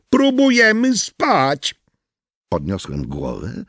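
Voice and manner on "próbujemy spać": high, slow shouted complaint; woman's shout. Acted. Woman on balcony shouting at noisy garbagemen. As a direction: yell down from the balcony. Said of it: "jakiś głos przeciął".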